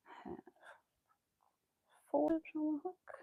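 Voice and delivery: soft whispered tally of loops; whispered counting. Real, not acted. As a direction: whisper the count under your breath. Counting loops while crocheting.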